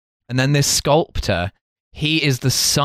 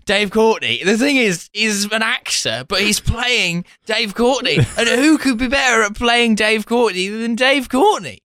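much higher, slow and drawn out, mocking; coked-up media man; 10 semitones higher than usual. Host mocking a coked-up documentary maker, slow and high. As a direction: lampoon him like he's high on coke.

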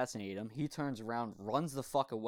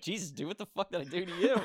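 mock exasperation; 8 semitones higher, 5 dB louder than usual. Exasperated quote of protagonist.

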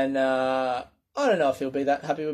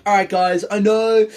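higher, louder forced cheer; forced upbeat; 6 semitones higher, 6 dB louder than usual. Retold or imitated. Comedian greeting a tiny audience, forced cheer. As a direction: fake enthusiasm for a tiny crowd. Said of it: "trying to stay upbeat".

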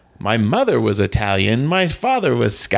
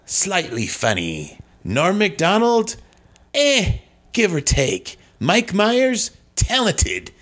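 hammed-up verdicts with stretched final name; theatrical inflection; 5 semitones higher, slower than usual. Comic guest rates his castmates, theatrical inflection. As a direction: pronounce judgement with swooping comic intonation.

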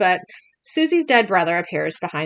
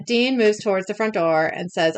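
ordinary talk, nothing marked

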